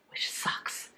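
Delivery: slow, soft whisper, heavy-hearted; hushed whisper. Real, not acted. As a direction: let it fall to a whisper. Leaving her church, heartbroken.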